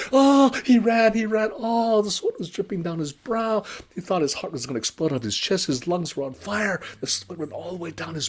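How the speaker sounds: fast, high, loud panicked telling; breathless chase. A narrator's reading. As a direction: race through it like running for your life. Frantic flight narration.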